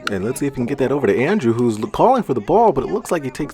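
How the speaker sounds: fast, rising play-by-play; building excitement on the attack; excited. Real, not acted. Attack builds toward goal.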